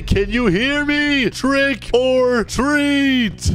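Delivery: drawn-out, high trick or treat; slow and loud. Retold or imitated. Gamer speaks slowly to the grandma who can't hear. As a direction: stretch each word for a deaf granny. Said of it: "I'm gonna say".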